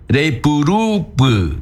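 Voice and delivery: Rei puru pu said slow and high; slow example phrase. Shown as a demonstration. Teacher giving a slow example phrase to the class. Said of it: "example phrase".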